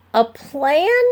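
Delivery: high screechy disbelief. A narrator's reading. Ben doubting Pearl's plan, high screechy voice. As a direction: squeak it out in disbelief. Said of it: "Ben's voice got kinda screechy".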